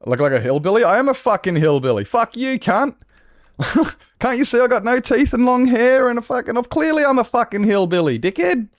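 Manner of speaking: high-pitched, ranting comeback; outraged and sweary, pitch way up; indignant retort. Real, not acted. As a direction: fire back at the insult, voice climbing high. Indignant reply to chat insult.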